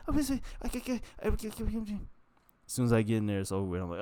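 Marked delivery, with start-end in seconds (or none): 0.1-2.0 very high whimpering excuses; whining. Retold or imitated. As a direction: whimper like a scared child. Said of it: "I'd be whining".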